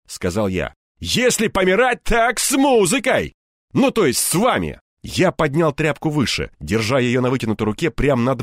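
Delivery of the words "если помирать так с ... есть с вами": high, ringing bravado; reckless defiant shout. Acted. Hero ready to die with the thugs, wild shouting. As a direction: shout it like a desperate man's threat. Hero threatens to blow everyone up.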